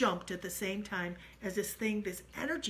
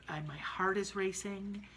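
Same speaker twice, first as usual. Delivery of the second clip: ordinary talk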